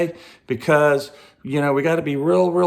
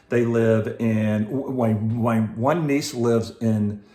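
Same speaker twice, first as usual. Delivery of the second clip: ordinary delivery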